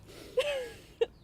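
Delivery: harsh coughing; real cough. Real, not acted. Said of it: "[name]'s dying a little bit".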